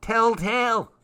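high singsong tease; taunting elf. Acted. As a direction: jeer at her like a cheeky imp. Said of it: "called Larch".

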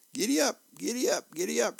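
high-pitched playful giddy-up yell; child's yell. Acted. Little Wren riding piggyback on Dad, yelling. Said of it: "she yells".

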